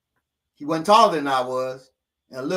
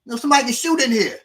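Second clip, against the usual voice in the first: high, loud urgent call to close the curtain; hurried warning, pitched up high; 11 semitones higher, 5 dB louder, faster than usual. Retold or imitated. Husband warning his wife about the open curtain, urgently.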